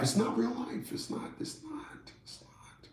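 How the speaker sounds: drops from quiet to a whisper; fading to a whisper. Real, not acted. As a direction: let it die away into a whisper.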